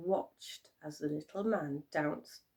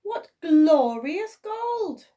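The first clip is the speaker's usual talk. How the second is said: loud, high, slow delighted cry; cheering king; 10 semitones higher, 10 dB louder, slower than usual. A narrator's reading. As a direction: boom out your delight at the gold. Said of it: "he cheered".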